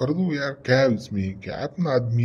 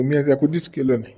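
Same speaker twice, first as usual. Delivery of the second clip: usual speaking voice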